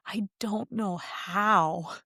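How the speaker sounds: slow, drawn-out imitation of a child; wired little boy. Mother imitates wired son who can't sleep, slow and drawn out. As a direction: stretch each word like an overexcited kid.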